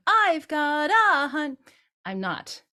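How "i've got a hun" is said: mock louder sung fragment; louder and higher. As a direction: imitate a student's louder idea. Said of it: "aren't you just going louder".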